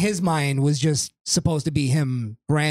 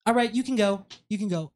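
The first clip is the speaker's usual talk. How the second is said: casual dismissive; 6 semitones higher, faster than usual. Retold or imitated. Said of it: "his casual nature".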